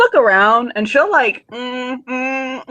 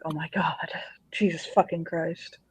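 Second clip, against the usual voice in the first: muttered dismay; 4 semitones lower, 13 dB quieter than usual. Retold or imitated. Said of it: "Lucio's just like".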